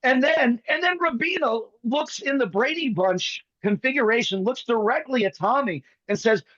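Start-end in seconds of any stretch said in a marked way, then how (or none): none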